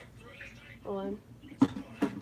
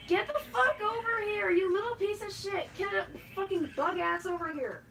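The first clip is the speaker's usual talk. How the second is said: Rick-like rant; 5 semitones higher than usual. Real, not acted. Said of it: "I sound like Rick, like Morty when he fucking stammers".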